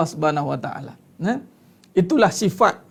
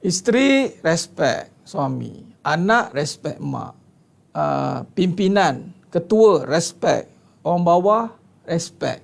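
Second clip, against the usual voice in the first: slow emphasis; 2 semitones lower, slower than usual. Shown as a demonstration. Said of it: "teaching slow emphatic list".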